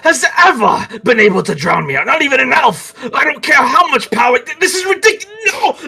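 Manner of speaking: disbelief climbing to a high shout; haughty indignation building to a desperate yell; outraged villain. Acted. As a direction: sputter in fury as you lose control. Villain being magically drowned out, outraged.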